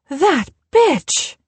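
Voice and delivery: hissing it out in shock under her breath. A narrator's reading. Barbara reacting to Lizzie storming off, breathy curse. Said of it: "she breathed".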